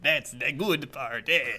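slow mock Italian accent; hammed-up Italian accent; old Italian man. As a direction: imitate an old Italian man with a knowing aside. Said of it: "Just like this old Italian man".

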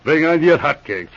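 ordinary delivery

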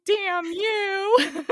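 high mock-crying with a laugh; mock tearful. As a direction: playfully curse through pretend tears. Retells tearful reply to a gift.

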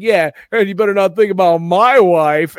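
loud, high stage-comic impression; stand-up comic. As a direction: imitate a comedian working the crowd. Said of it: "he's, like, talking about".

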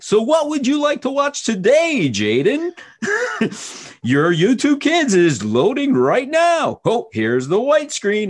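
high, slow, loud game-show hostess voice. Pretend. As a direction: present the menu like a TV hostess to a giggling son.

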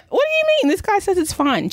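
high-pitched, startled what do you mean; shocked disbelief. Retold or imitated. Retelling her shock at the friend's recall news, high and startled. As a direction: jump way up in pitch, caught off guard. Hearing the car had recalls.

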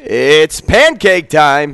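long, drawn-out loud holler; hollered catchphrase. Retold or imitated. Host announces pancakes at live event, hollered catchphrase. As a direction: stretch every word into a big shout.

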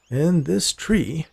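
slow, hushed awe at the tree; awed. A narrator's reading. Man gazing at the old oak, slow and awestruck. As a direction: linger on it in wonder. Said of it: "with a tone of awe in his voice".

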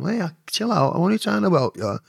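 high, soothing put-on voice; placating. Man calms the cheeky girl, high placating voice.

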